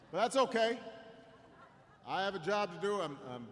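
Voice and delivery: ordinary delivery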